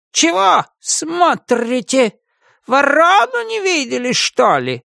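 high, slow, cawing crow voice; grumpy crow voice. Acted. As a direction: squawk it as a cranky crow. Grumpy crow Marta speaks.

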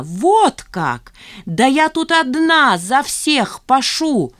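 slow, high emphatic outburst; indignant protest. A narrator's reading. Zhanna insisting she works for everyone, indignantly. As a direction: drive each word home indignantly. Stung employee protesting to her boss.

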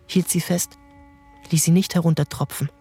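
usual speaking voice

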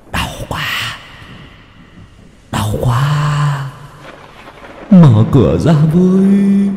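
faint, moaning plea from beyond; wailing ghost. Acted. Spirits of dead family beg to be let in, faint moaning.

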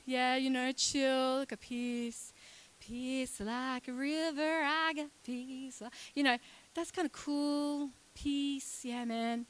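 mild, slow, dreamy hippie drawl; lightly voiced laid-back hippie. Preacher mimics a chilled-out hippie, lightly voiced. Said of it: "this whole hippie peace love man".

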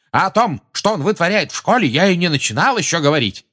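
high, loud, indignant burst; exasperated official ranting; heated accusation. A narrator's reading. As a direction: pile on another charge at full pitch. Minister piling on accusations.

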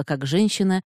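usual speaking voice

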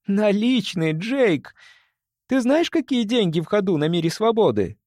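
high, insistent explaining; excited emphatic. Acted. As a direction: make him see it. Rhonda exasperated explaining.

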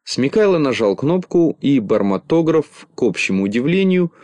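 ordinary delivery, nothing marked

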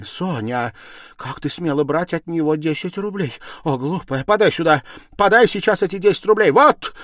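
high, loud, building to a shout; indignant scolding. A narrator's reading. Stepmother demanding the banknote from Sonya, agitated scolding.

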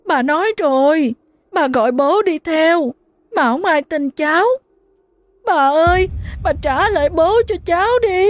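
put-on a little girl begging grandma for her father back; choked wailing child. A narrator's reading. Little girl to her dead grandma, wailing and choked. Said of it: "giọng nghẹn ngào".

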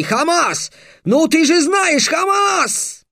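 high hysterical pleading scream; frantic screaming. Acted. Beaten Alkhazab screams at Hamas to kill Trix, hysterical and high. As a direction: beg and scream for help, losing it. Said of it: "закричал".

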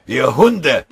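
very loud, high-pitched curse; enraged roar. A narrator's reading. Soldier cursing the attackers under fire, furious bellow. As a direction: roar it at the enemy. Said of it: "brüllte Mörschinger".

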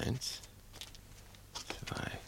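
hushed counting of stairs; soft counting. Acted. Max counting stairs softly in the dark, hushed. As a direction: count the steps under your breath.

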